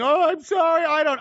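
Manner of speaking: high, loud whiny plea; hammed-up groveling apology; mock begging enemy. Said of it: "begging, pleading".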